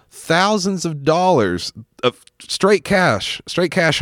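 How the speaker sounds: excited. Real, not acted. Excited reveal of hidden cash.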